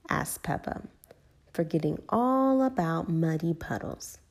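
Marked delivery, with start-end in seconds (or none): none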